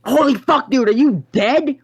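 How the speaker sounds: high, loud panicked quote; freaked-out friend. Retold or imitated. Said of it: "everyone's freaking the fuck out".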